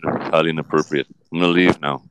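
Johnny Depp. Acted. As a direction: answer low with thoughtful pauses. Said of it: "Johnny, come on".

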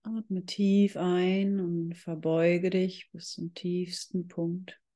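usual speaking voice

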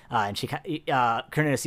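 normal talking voice

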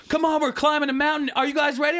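high, rousing class-leader call; rally the riders like an instructor; hyped spin instructor. Retold or imitated. Spin instructor to riders climbing a mountain, rousing call.

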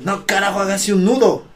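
high, loud officer's order, imitated; stern captain. Captain denying a toilet break, imitated loud high order. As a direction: refuse like a captain on parade. Captain refusing the recruit's request.

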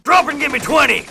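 high, loud barked command; drill sergeant. Acted. Military school in ad.

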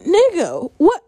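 high, incredulous what with a laugh; can't believe what she heard, laughing; shocked laugh of disbelief; stunned disbelief. Real, not acted. Outraged disbelief at driver.

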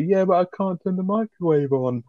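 a hint of a spaced-out woman whining about her microwave; imitating stoned girlfriend.

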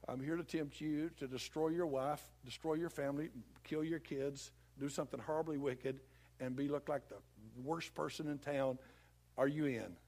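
lightly voiced devil, low and soft; devil's blunt proposition. Devil openly tempting a believer, mock casual low voice. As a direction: make an evil offer in an understated, low voice. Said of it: "He would never come to you and say".

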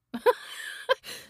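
high airy cackle of a gloating witch; old witch cackling at her cleverness. Acted. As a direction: cackle breathily, pleased with her own trickery. Witch gloats over her trick.

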